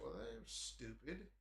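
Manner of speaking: slow, lightly put-on consoling voice; mock comforting. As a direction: soothe her half-jokingly. His reply in a put-on voice.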